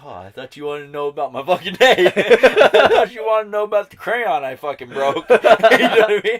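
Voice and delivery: high, loud laughing mock retort; mimicking her own indignant comeback while laughing. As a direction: sass it back through laughter. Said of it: "until someone's like".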